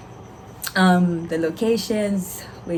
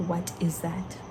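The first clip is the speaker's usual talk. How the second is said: puzzled under breath; 9 dB quieter than usual. Retold or imitated. Seeing stoma bag first time.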